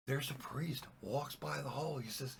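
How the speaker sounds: ordinary delivery, nothing marked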